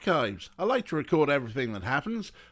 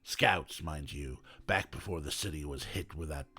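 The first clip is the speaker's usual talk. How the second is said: rough, much lower and quieter detective voice; Detective McQueen voice; 9 semitones lower, 7 dB quieter than usual. Acted. Detective thanking the kid for the invite, in a rough, much lower voice. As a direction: drop low and rough like a weary cop.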